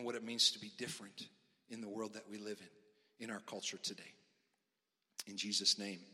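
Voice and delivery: soft, low, hushed prayer voice; quiet prayer. Acted. Pastor praying over the congregation, soft and low. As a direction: pray gently on behalf of the room.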